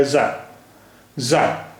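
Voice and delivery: जा buzzed as za, not a hard j; z sound. Shown as a demonstration. Teacher showing the dotted letter, जा buzzed as za. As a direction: buzz जा like za. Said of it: "नीचे बिंदी रख दी गई है".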